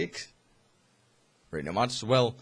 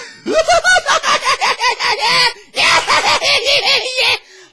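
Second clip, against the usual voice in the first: very high, loud shrieking cackle; maniacal laugh; 14 dB louder than usual. Retold or imitated. The Joker's first laugh, a loud shrieking cackle. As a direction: cackle like a madman. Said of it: "started laughing like".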